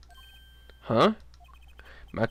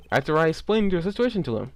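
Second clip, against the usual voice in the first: ordinary delivery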